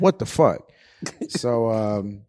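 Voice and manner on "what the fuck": mock disbelief. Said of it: "I'm like".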